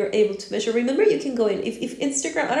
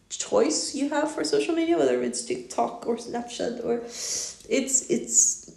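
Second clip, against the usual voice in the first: ordinary talk, nothing marked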